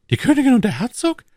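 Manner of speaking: high, loud questioning cry; startled exclamation. A narrator's reading. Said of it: "rief Richelieu". (lauter, hoher Ausruf)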